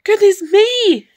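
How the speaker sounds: high, loud startled giantess; shocked high-voiced exclamation; alarmed cry. A narrator's reading. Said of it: "cried the giantess".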